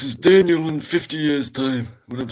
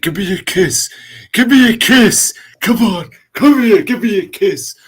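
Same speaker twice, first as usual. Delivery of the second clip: much louder, higher begging; loud pleading; 6 semitones higher, 11 dB louder than usual. Pretend. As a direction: demand a kiss, loud and pleading. Old man filter asks kiss.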